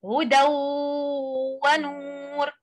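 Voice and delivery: هدى ونور read joined, the tanween of هدى merged into the و with nasal ghunna; continuing with idgham. Shown as a demonstration. Teacher demonstrating continuous reading, tanween merged with nasal ghunna. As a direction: recite هدى ونور without pause, tanween assimilated into واو. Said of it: "Like هدى ونور in الوصل".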